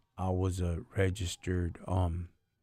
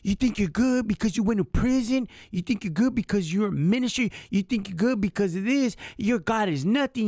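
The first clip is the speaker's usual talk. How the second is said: high, loud mocking imitation; mock her sneering voice, pitched way up; mimicking the hateful caseworker; 5 dB louder than usual. Telling a friend how the child support officer sneered, high mocking voice. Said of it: "I'm gonna, I'm gonna mimic it".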